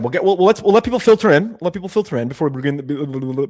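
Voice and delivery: ordinary talk, nothing marked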